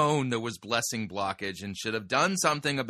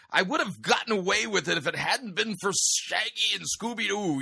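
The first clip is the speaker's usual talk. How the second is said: cartoon villain voice; 7 semitones higher than usual. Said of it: "Scooby-Doo villain quote".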